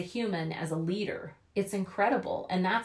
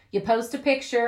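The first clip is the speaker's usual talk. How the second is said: ordinary delivery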